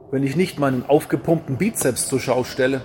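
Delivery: normal talking voice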